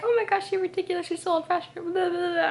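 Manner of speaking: high, loud mock whine; mocking complaint. Mocks Bella's complaint.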